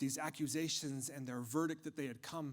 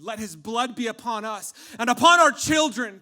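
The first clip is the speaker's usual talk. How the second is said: loud, raised-pitch yell; shouted from crowd; 7 semitones higher, 19 dB louder than usual. Acted. Someone in the crowd answers Pilate, shouting. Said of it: "someone from the crowd shouted".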